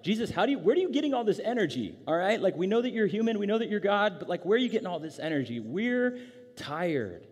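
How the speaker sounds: high, loud comic protest; hammed-up incredulous griping; exasperated disciples. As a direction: complain in disbelief, worn out by his energy. Acts tired disciples protesting to Jesus.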